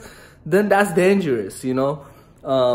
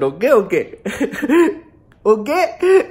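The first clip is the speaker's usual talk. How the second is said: put-on Indian accent; 5 semitones higher than usual. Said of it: "Number one is the Indian accent".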